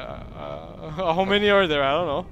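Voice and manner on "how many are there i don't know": loud, high laughing panic; panicked laugh. Real, not acted. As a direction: laugh through the chaos. Overwhelmed in firefight.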